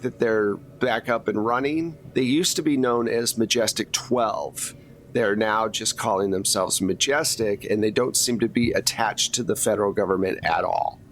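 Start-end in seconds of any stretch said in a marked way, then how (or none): none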